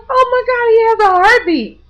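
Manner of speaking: hearing a heartbeat in her ear, horrified; shocked cry. Retold or imitated. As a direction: gasp it out in horrified disbelief.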